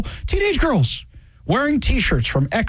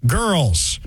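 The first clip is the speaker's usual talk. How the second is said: each word laid out slowly; slow emphatic quote; 4 semitones higher, much slower than usual. Retold or imitated. Quoting the player, slow and deliberate. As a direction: spell it out slowly like it's obvious. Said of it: "She said".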